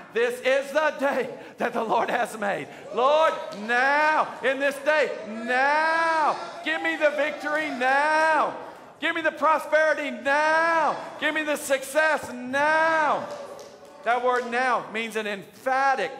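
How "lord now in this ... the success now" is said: shouted, high prayer, hammering each now; preaching at full force. Acted. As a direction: demand it right now from God.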